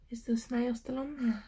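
hushed, breathy whisper of suspense; spooky storytime whisper. Acted. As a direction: lean in and whisper it like a secret. Whispering to children during story.

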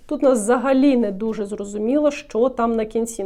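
ordinary talk

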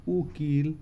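normal talking voice

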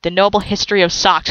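usual speaking voice

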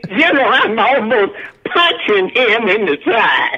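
talking through laughter; real amused laughter in the words; laughing. Real, not acted. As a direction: tell it while laughing. Laughing at a funny scene.

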